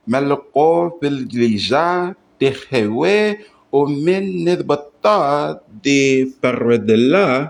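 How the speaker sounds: measured recitation of an unfamiliar Aramaic text; sight-reading aloud. Shown as a demonstration. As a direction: read it out syllable by syllable, leaning on each word's accent. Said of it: "I'm just gonna sight read".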